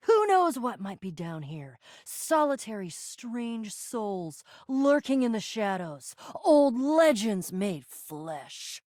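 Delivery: low, slow, spooky musing; ominous. Acted. To companions about what lurks below, ominously. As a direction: draw it out low like a ghost story.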